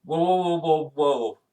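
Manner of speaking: loud urgent whoa, hands up; alarmed whoa. Acted. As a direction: stop someone fast and loud. Hector stops them, GM voices.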